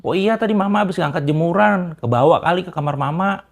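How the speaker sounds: high, loud offhand explanation; blurted-out excuse. Retold or imitated. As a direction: imitate mother-in-law quickly covering up. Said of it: "langsung untung ngejawab celetuk".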